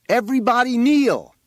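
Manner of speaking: high, full-voiced yell; shouted command. A narrator's reading. Boy on the roof ordering everybody to kneel, shouted command. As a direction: yell it out to a whole crowd.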